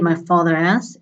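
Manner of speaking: ordinary delivery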